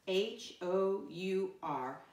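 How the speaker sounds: slow, separated letters; spelling aloud. Shown as a demonstration. Teacher spells a word with pupils, slow spelling aloud. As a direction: say each letter slowly and distinctly.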